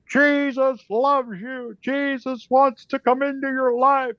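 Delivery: high, slow megaphone preaching; megaphone preacher. Retold or imitated. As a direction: call it out like a street preacher through a megaphone. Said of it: "he's got this megaphone".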